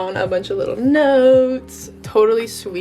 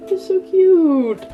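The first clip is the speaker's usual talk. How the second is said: delighted squeal; 11 semitones higher, 6 dB louder, slower than usual. Real, not acted. Delighted at page.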